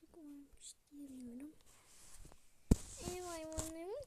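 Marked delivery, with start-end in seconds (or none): 0.0-1.5 unvoiced and soft; whisper. Real, not acted. To herself at the keyboard, whispering. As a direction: whisper it like a complaint to yourself. Muttering to herself while playing.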